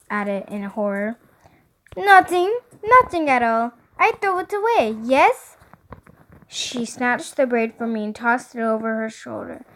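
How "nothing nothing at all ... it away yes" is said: lilting little stylist with fake French accent; phony French accent. A narrator's reading. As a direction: innocently brush it off in bad French. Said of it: "that jacked up acc-, accent of hers".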